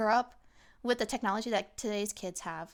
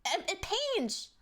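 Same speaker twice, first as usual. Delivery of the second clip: mock anguish; 10 semitones higher, much slower than usual. Acting pained by ruined furniture.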